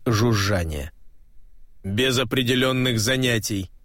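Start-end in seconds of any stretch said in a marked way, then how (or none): 1.8-3.7 toneless metallic machine voice; metallic robot voice. A narrator's reading. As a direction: state it like a flat machine. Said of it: "сказал металлический голос".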